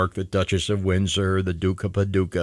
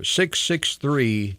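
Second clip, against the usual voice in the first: ordinary delivery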